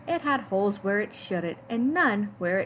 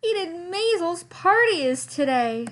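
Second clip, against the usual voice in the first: high, loud panicked cry; 9 semitones higher, 4 dB louder than usual. A narrator's reading. Lester panicking about the party, high and loud. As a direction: blurt it in alarm.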